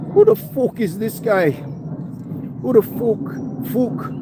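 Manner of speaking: high-pitched mock Irish fighter taunt; cocky Irish heckle in a high put-on voice; Conor McGregor. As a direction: put on a high Irish brogue, stretching the swear word into "fook". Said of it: "Imagine Conor McGregor".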